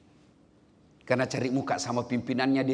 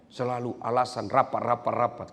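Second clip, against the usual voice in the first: ordinary talk, nothing marked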